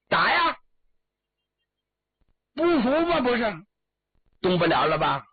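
high, goading jeers at a fallen foe; storyteller's victor mocking the beaten man; gloating taunt. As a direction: taunt him upward, short and high. Victor taunts his fallen enemy.

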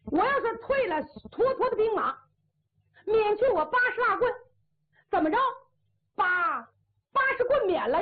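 high, whining protest; pleading braggart. Acted. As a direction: plead your case, half crying. Condemned man protests to marshal.

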